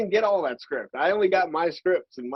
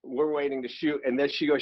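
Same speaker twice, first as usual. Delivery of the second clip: normal talking voice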